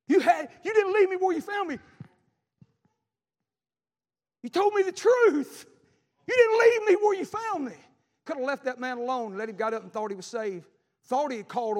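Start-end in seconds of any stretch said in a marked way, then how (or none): 0.1-7.7 emotional gratitude. Retold or imitated. Grateful ex-inmate thanking preacher.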